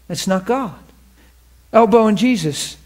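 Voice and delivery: ordinary talk, nothing marked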